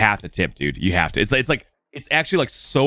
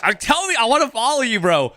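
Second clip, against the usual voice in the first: high, eager voice of an amazed mover; high-pitched mover voice in disbelief; 10 semitones higher than usual. Retold or imitated. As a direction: play the stunned, thrilled mover. Mahmoud's amazed reaction retold.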